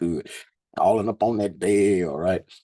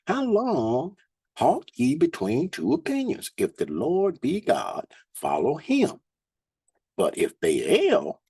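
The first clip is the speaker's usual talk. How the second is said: scripture reading; 9 semitones higher than usual. Acted. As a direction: lift the verses up like a preacher. Reads scripture aloud.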